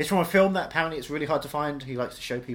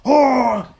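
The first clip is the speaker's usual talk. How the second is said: loud, slow crying-out voice; excited shout; 6 semitones higher, 12 dB louder, much slower than usual. Retold or imitated. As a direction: wail it with joy. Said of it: "he was like".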